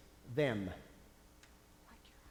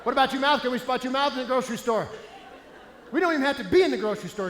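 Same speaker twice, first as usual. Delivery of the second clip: ordinary delivery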